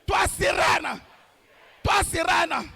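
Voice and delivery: ordinary talk, nothing marked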